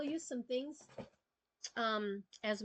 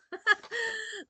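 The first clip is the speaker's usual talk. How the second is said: a quick high burst of laughter; short laugh; 12 semitones higher, 5 dB louder than usual. Real, not acted. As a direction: laugh it off, amused at yourself. Laughs at her own mix-up.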